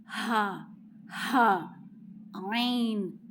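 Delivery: हा, हा, आइन voiced from the middle of the throat; teacher demoing throat letters; throat sounds demo. Shown as a demonstration. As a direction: push हा and आइन from mid-throat. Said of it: "इसकी आवाज़ कैसी होगी?".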